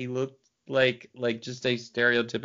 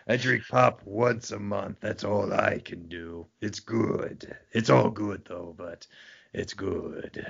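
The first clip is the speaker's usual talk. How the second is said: evil sinister voice; 2 semitones lower, slower than usual. Retold or imitated. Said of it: "That's how he sounded, too".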